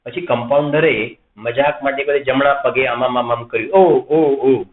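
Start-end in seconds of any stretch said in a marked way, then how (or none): 3.7-4.6 drawn-out imitated ow-ow cries, a bit louder; mock cries of pain. Patient crying out when the compounder presses his leg, mock cries. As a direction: ham up a patient's ow-ow-ow. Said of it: "એને પેલાને બૂમ પાડવાની ટેવ".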